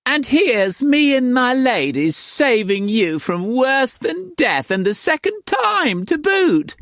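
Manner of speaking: very high, spluttering landlady; outraged madam. A narrator's reading. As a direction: sputter with fury.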